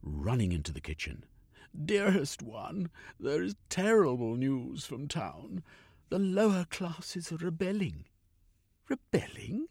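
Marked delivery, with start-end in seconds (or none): 1.8-8.0 a flustered aristocrat, high and alarmed; alarmed gentleman. Acted. Cornucope voiced to his wife. 8.9-9.7 very high, incredulous rising echo; a lady aghast at the news; high-pitched disbelieving lady; shocked question. Acted.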